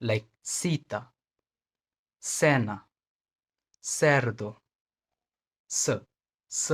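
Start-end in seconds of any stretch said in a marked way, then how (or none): 0.4-2.9 सीता and सेना with a crisp s at the start, the first drawn out slowly; clear s sound. Shown as a demonstration. Teacher showing learners the Spanish s sound. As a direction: open सीता and सेना on a clean hissing s. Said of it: "तो इसका pronunciation स होगा".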